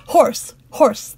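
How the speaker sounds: a lord shouting orders after a fleeing knight; loud cry. A narrator's reading. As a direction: cry out for the chase. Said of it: "the Douglas cried".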